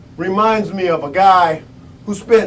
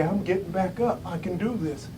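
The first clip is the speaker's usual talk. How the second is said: very soft, low murmur; almost whisper it to yourself, lower; hushed self-talk; 4 semitones lower, 14 dB quieter than usual. Retold or imitated. Prisoner steeling himself in his cell, hushed.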